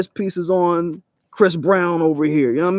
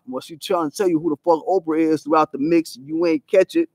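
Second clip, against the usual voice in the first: normal talking voice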